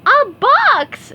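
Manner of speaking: high, loud squeal of delight; excited shout. A narrator's reading. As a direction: burst out thrilled. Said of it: "shouted SpongeBob".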